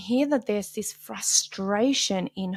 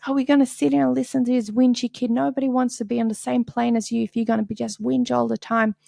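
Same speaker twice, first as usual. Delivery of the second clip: scolding mom; 4 semitones higher, 4 dB louder, faster than usual. Retold or imitated. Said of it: "And she goes".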